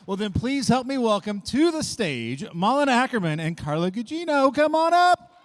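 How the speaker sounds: loud, high stage introduction; announcer shout. Real, not acted. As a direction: bring them on like a host. Host announces guests to stage.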